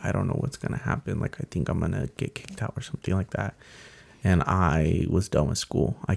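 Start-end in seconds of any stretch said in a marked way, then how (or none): 0.1-3.5 scared whisper. Retold or imitated. Scared, telling brother in class.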